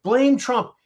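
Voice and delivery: high, loud mock slogan; mocking Democrats. Host parrots the Democrats' playbook, high mocking cry. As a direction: squawk it like a parroted party line. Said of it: "What is their playbook?".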